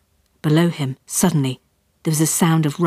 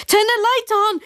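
panicked higher yell; dad yelling to his child in panic. Acted. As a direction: bark it across the room. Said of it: "he yelled in my direction".

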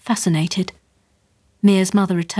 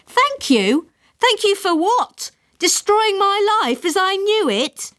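much higher, slower, disbelieving; indignant outburst; slower than usual. A narrator's reading. Mortified girl silently protests her mother's thanks, much higher. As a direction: squeak it out in horrified disbelief.

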